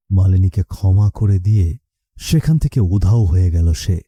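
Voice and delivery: usual speaking voice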